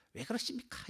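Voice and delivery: high, soft mock protest; lightly acted high-pitched question; wrong way of pleading. Shown as a demonstration. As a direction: demonstrate a complaint in a high, small voice. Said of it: "이게 아니고".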